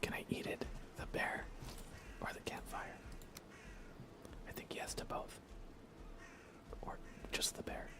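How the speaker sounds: normal talking voice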